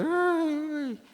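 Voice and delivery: put-on high wailing ay of a lost child; imitated crying child. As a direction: wail it like a small child. Imita el llanto de un niño perdido. (imitando el llanto agudo de un niño)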